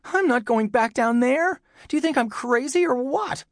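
put-on very high, protesting boy; horrified. A narrator's reading. Tom refusing to return to the cellar, horrified. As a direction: refuse in a high, panicky kid voice.

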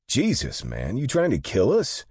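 high, loud alarmed cry; startled outburst. Acted. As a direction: yelp it as the truck nearly hits the wall. Near crash at wall.

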